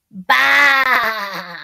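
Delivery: shouted bleating baa; sheep bleat. Acted. Sheep sound for children.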